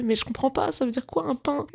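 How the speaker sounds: high, baffled self-imitation asking what it means; mock confused self. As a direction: play yourself baffled and a bit silly. Mocking her own confusion.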